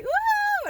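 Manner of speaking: very high, loud whoop; giddy mock whoop; imitated fluttering heart. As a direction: squeal a woo for a leaping heart. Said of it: "And my heart was like".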